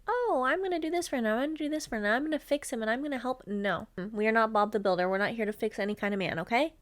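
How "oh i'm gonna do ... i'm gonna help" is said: higher, eager mock sing-song; mock eager fixer. Mocking women who try to fix men, in a higher eager voice. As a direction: parody a girl set on fixing her man. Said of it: "you doing all the fucking work".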